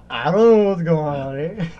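goofy loud dragon voice; voicing the dragon. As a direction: put words in the dragon's mouth, loud and puzzled. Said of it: "Look at the dragon's arms like".

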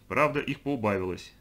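ordinary delivery, nothing marked

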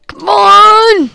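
loud high yell at the game; frustrated shout. Real, not acted.

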